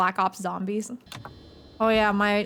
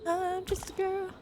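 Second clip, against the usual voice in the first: put-on high, soft little-girl voice; breathy girly voice; 8 semitones higher, 8 dB quieter than usual. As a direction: coo it like a helpless girl. Repeated meme line.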